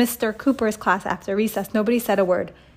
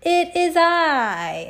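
very slow, high, dramatic proclamation; grand announcement; 8 semitones higher, 4 dB louder, much slower than usual. Acted. Superhero entering, very slow and grand. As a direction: announce it like a hero arriving. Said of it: "announced the".

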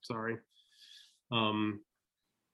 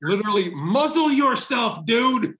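gleeful; 9 semitones higher, 8 dB louder than usual. Real, not acted. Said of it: "Love it".

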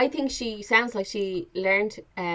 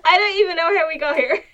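high, loud, words through laughter; real laughter near tears; laughing; 9 semitones higher, 6 dB louder than usual. Real, not acted. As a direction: say it while you can't stop laughing. Giggling fit over the tangent.

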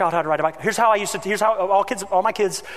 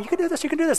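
cheering; 13 semitones higher, much faster than usual. Retold or imitated. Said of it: "I'm running behind".

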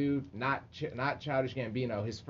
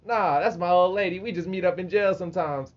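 mock defensive; 8 semitones higher, 9 dB louder than usual. Retold inmate defends his partner.

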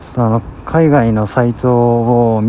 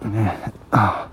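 mid-burp; words broken by a burp; 6 dB quieter than usual. Real, not acted. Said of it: "すごいゲップが出る。".

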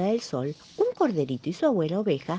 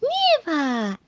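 higher delighted cry; excited exclaim; 12 semitones higher than usual. Acted. Bunny seeing snow fall, excited exclaim. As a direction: call it out thrilled at the first snow. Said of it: "exclamó el conejito".

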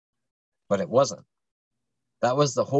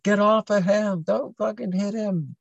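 much higher, desperate mock scream; frantic yell; 11 semitones higher than usual. Girlfriend defending him from his mom, frantic yell. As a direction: scream at her to stop.

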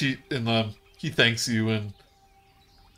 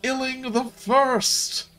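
raised pitch, drawn-out crowd chant; high, slow proclamation; 13 semitones higher, slower than usual. Acted. Bullywugs around the pike proclaiming the new king, high and slow.

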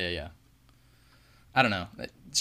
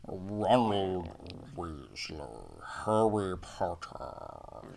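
very slow, nasal and regal; nasal regal Rickman; much slower than usual. Retold or imitated. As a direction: drag each name out nasally. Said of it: "overly nasally, really, like, regal".

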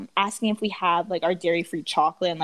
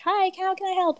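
customer service voice; 10 semitones higher than usual. Retold or imitated.

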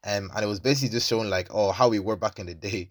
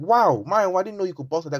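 1 mock amazement; 7 semitones higher than usual. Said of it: "I was like".

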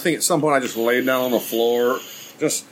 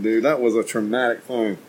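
ordinary talk

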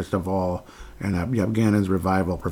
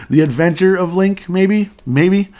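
high, loud, doubtful guessing; unsure of his own answer, pitched up; unsure, rising; 10 semitones higher, 8 dB louder, slower than usual. Real, not acted.

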